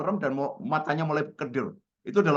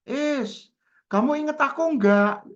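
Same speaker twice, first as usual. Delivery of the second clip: high, loud, drawn-out call down the phone; caller's excited greeting; 13 semitones higher, 5 dB louder, much slower than usual. Retold or imitated. Old friend phones out of the blue, high excited imitation. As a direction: imitate an over-familiar old friend calling loudly. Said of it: "Suara di seberang sana".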